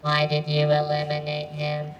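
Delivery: ordinary talk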